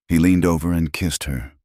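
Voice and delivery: ordinary delivery, nothing marked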